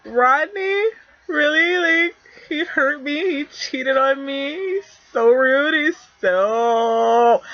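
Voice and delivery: put-on high, whiny complaining girlfriend; heartbroken friend. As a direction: whine it up high like she's hurt. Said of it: "a friend comes to you and is like".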